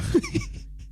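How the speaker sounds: very high airy giggle; high breathy laugh. Real, not acted.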